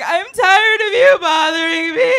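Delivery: high, loud, slow tearful yell; crying outburst. Retold or imitated. Kid finally snapping at her bully, loud and high. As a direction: scream it out, fed up and in tears. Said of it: "I was like".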